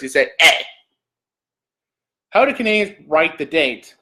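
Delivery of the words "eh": sudden punched "eh"; mock-cursing "eh". Shown as a demonstration. Vlogger showing how Canadians curse, a punched mock curse. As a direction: punch out "eh" like a swear word. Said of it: "even if you want to curse".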